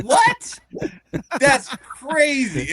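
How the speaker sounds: yelped high and loud, then slow; can't believe what you heard; a loud, high yelp of shock; stunned disbelief. Real, not acted. Outraged at the slur.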